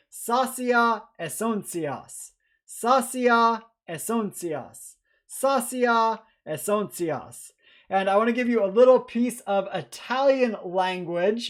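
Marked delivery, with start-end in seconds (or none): 0.1-7.5 slow, higher-pitched recitation of the mnemonic; leading a repeat-after-me. Shown as a demonstration. Lecturer has students repeat a mnemonic three times, slow recitation. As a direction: say it slowly and evenly for a class to join in. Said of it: "say it with me three times".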